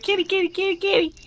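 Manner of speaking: high sing-song kitty call; calling the cat. Retold or imitated. Owner calling a cat to scare her parrot, sing-song. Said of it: "I'll be like".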